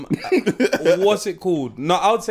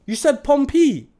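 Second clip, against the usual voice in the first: accusing; 6 semitones higher than usual. Retold or imitated. Challenging friend's odd pronunciation.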